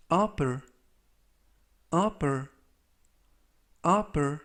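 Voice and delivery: upper said as UH-per, with stress on the first syllable; careful English pronunciation. Shown as a demonstration. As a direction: model upper clearly for students. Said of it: "이라고 발음하시면 되겠고요".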